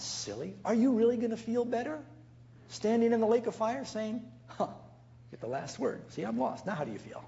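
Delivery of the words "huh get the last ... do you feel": softer, half-whispered smug gloat; a sulky spiteful jab, barely voiced; preacher mocks a lost soul gloating at God, smug; mock smug taunt. Mocks taunting from lake of fire.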